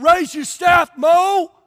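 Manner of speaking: booming shout of God's order; the voice of God yelling at Moses to raise the staff; shouted command. Retold or imitated. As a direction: call it out hard to Moses. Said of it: "God said".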